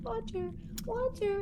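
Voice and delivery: tiny, high-pitched little plant; soft high child's voice calling out; little kid's voice. Acted. Imitating the game's little plant for players, high kid's voice. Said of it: "with a little kid's voice saying".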